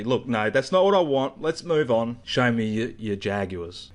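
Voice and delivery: ordinary delivery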